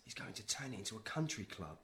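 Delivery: soft, confidential murmur; hushed private explanation; conspiratorial undertone. Acted. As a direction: tell it quietly, out of the others' earshot. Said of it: "what's all this whispering?".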